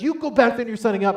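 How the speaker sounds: loud, high-pitched command in a quarrel; insistent wife. Retold or imitated. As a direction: order your husband back, loudly. Wife's side of acted quarrel.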